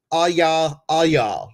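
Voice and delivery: loud, high catchphrase call; playful catchphrase. Acted. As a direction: belt it out for fun.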